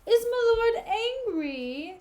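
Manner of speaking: high, loud, drawn-out fake surprise; hammed-up innocent question, stretched out slowly; mock astonishment. Iago asking whether his lord is angry, exaggerated mock shock.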